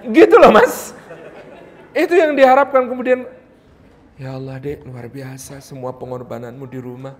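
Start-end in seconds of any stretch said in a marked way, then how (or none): 4.2-7.1 "Ya Allah, Dek" said much softer and lower, gently admiring; tender praise. Retold or imitated. Husband gently praising his wife for her sacrifices, soft and tender.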